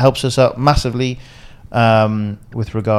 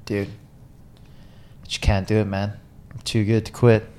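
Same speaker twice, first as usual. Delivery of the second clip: mock deadpan excuse; 3 semitones lower, 7 dB quieter, slower than usual. Retelling his own deadpan excuse.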